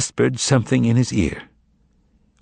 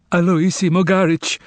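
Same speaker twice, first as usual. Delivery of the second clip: trembling; 9 semitones higher than usual. Acted. Terrified man fallen from the ceiling, trembling. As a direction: blurt your name in terror. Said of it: "the latter replied, trembling".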